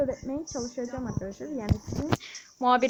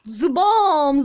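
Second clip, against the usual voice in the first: loud hit sound; 8 dB louder than usual. Shown as a demonstration. Gamer voicing his hero's strike, loud Zıbam. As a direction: punch out Zıbam loudly like a game strike. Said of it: "zıbam diye vuruyoruz böyle".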